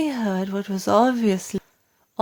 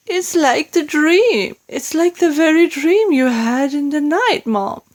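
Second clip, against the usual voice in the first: maid; 8 semitones higher, 4 dB louder than usual. A narrator's reading. Maid breaking the news.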